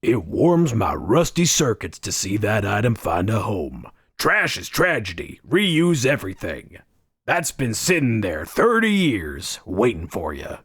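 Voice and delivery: put-on game shopkeeper voice; hammed-up shopkeeper character; junk shopkeeper. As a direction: play a junk-dealer NPC, pitch swooping. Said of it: "like, a junk shopkeeper. He's like".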